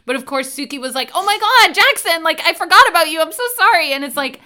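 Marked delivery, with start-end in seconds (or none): 1.1-3.9 frantic apology. Retold or imitated. Suki voiced apologising to Jackson.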